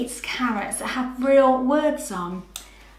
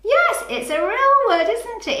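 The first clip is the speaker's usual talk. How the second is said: excited praise; 11 semitones higher, 4 dB louder than usual. Real, not acted. Praising a child's answer.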